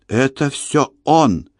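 barely breathing, faint words; breathless. A narrator's reading. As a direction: gasp it out with no air. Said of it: "едва дыша сказала Кора".

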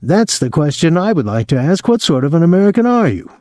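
high, quick righteous goading; mock outrage. A narrator's reading. Friend echoes the patriotic taunt.

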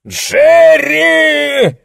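a high, loud roar of a name; furious yell. Acted. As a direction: bellow the boy's name in rage. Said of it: "заорал он".

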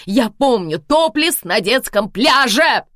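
loud, slow exasperated yell. Acted. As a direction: bellow it at her. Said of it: "заорала я".

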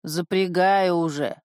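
slow, husky reply; hoarse voice. A narrator's reading. Man behind the shed answers the healer, hoarse voice. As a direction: answer slowly with some hoarseness. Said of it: "послышался сиплый голос".

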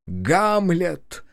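ringing showman's announcement; grand entrance announcement. Acted. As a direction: announce the play with ceremony. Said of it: "торжественно объявил Ильич".

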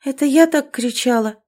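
ordinary delivery, nothing marked

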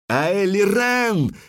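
high, drawn-out battle cry; loud cry. A narrator's reading. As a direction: call the name long and high. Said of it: "крикнула белка громко".

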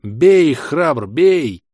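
loud rasping battle cry; hoarse yell. A narrator's reading. Hero yelling at his ally to strike, hoarse loud cry. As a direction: bellow it hoarsely, urging on. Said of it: "хрипло вопил я".

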